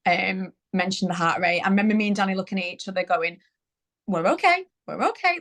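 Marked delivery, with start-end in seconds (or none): 4.1-5.4 high-pitched, rising mock reassurance; retold nervous reassurance. Couple reassuring each other over baby's heart rate, trying not to panic. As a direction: imitate keeping panic down, each okay lifting upward. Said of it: "trying not to panic each other".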